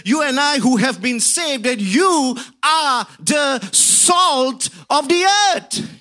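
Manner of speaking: high, loud preaching, shouting the big lines; full-force preaching. Acted. Preacher rousing the congregation about salt, preaching at full force. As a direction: drive it home to the congregation like a revival preacher.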